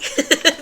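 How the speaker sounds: short, shaky nervous giggle; a tense little laugh as tears come. Real, not acted. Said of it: "And that's a nervous laugh".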